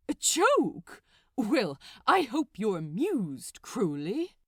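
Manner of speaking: loud, cutting sarcasm; bitter sarcasm. Acted. Aziraphale sarcastically answering Crowley's joke, loud and bitter. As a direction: throw his words back at him.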